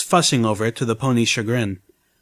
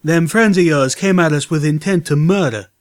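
defiant protest; 5 semitones higher, 4 dB louder than usual. A narrator's reading. Calamity defends himself.

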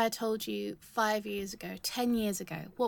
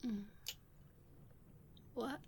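ordinary talk

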